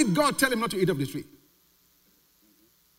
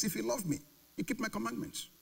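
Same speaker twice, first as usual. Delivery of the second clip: hushed, softer quote; quiet; 3 semitones higher, 11 dB quieter than usual. Shown as a demonstration. Preacher quoting Jesus softly to the congregation. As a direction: drop the voice on the verse. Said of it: "In other words".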